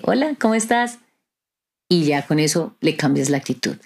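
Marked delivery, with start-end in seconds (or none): 0.0-1.0 bright high smiling greeting; smiling hello. Retold or imitated. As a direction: say hello through a big smile. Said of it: "se les sonríe". (saludo con una sonrisa, tono alto y alegre)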